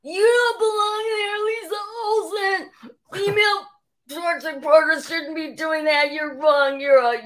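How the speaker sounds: a mocking, high-pitched imitation of a fan yelling at her; mock heckler.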